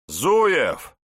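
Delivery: loud, high, sharp summons; barked. A narrator's reading. Investigator calls his sergeant, barking. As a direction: bark the name like an order. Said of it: "рявкнул Аникин".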